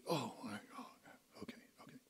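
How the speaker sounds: very soft, unvoiced resignation; weary whisper. Exhausted patient woken by alarms, weary whisper. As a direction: whisper it like someone half asleep.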